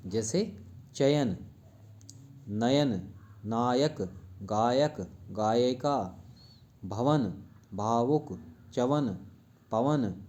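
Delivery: जैसे चयन said slowly, each syllable of चयन drawn out; slow, clear model. Shown as a demonstration. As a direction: spell out चयन slowly for the class to copy. (चयन को धीरे-धीरे, साफ़ बोलकर)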